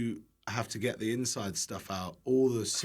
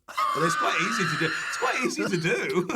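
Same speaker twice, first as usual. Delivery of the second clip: laughing; 7 semitones higher, 4 dB louder than usual. Real, not acted. Guest amused by his own pigeon-butchering story, laughing. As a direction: talk through rising giggles, higher pitched.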